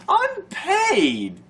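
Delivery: high squeaky giggling word; laughing. Real, not acted. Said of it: "without laughing".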